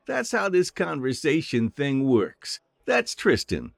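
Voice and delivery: high, eager little halfling voice; chirpy friendly halfling saying hello; cheerful halfling. Acted. Halfling chatters introductions.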